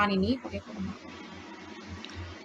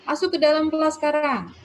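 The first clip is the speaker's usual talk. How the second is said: raised, louder classroom order; exclamatory command; 6 semitones higher, 6 dB louder than usual. Shown as a demonstration. Teacher ordering pupils into class as an example, exclamatory command. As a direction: call it out as an exclamation, high and loud. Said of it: "Ya, kan seru".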